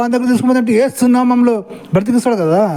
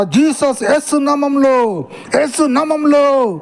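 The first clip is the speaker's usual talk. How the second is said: higher, slow, drawn-out mock preaching; mock preacher; 5 semitones higher, much slower than usual. Mocking Christians to his viewers, in a slow hammed-up preacher voice. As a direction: ham up a preacher calling on Jesus' name. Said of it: "అని నాటకాలు ఆడతారు కదా".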